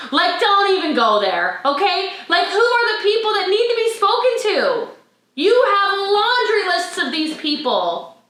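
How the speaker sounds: high, impassioned rallying voice; fired-up exhortation. Real, not acted. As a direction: challenge the team with rising fervour. Fired-up motivational plea to team.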